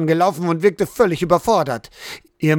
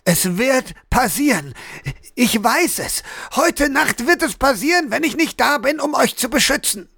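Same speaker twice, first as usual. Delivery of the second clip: building from measured insistence to higher, urgent pleading; urgent, desperate insistence; 7 semitones higher than usual. A narrator's reading. Husband warning his family about tonight's danger, urgent and desperate. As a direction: urge it with rising alarm.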